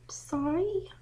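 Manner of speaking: high, small, innocent "Sorry?" with a rising end; little girl voice. Acted. Daughter plays innocent to her angry dad, little girl voice. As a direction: play the sweet little girl to get off the hook. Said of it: "Don't use that little girl voice".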